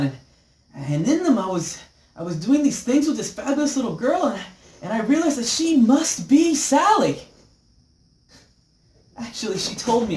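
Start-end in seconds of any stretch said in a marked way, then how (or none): 0.8-7.2 higher, louder, rising into joyful wonder; building excitement. Acted. Man recounts dreams of his unborn daughter, excitedly. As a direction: swept up in the thrill, getting louder.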